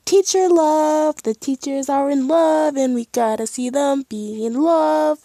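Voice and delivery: high, loud fangirl gushing; gushing excitement. Real, not acted. Gushing about the teachers' romance.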